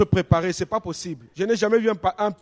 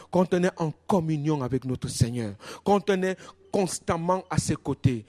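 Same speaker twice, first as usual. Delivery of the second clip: normal talking voice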